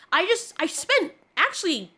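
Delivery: ordinary talk, nothing marked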